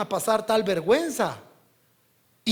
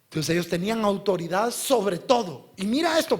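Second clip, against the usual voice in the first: ordinary talk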